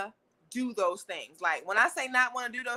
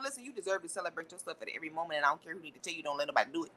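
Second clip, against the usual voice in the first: lower, fast, emphatic command voice; firm talk-down; 7 semitones lower, 8 dB quieter, faster than usual. Real, not acted. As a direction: lay it down like a stern big sister. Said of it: "I like when I talk like that".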